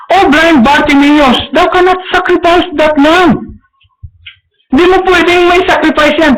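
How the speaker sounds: high priest forbidding Bartimaeus the sacrifice; commanding high priest. Acted.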